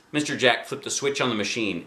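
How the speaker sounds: ordinary delivery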